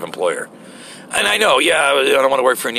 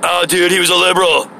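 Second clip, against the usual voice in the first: mocking MAGA fan; 6 semitones higher, 4 dB louder than usual. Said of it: "I can hear the maga people out there".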